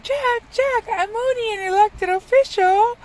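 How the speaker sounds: Mayor of Halloween Town. Retold or imitated. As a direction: squeal it out like a panicking small-town mayor. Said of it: "he starts freaking out".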